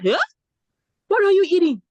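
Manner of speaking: high, loud Nigerian market-woman scold; mock a Nigerian auntie scolding in accent. Shoe seller scolding a growing schoolgirl, in a high Nigerian accent. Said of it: "I was saying it in Nigerian accent".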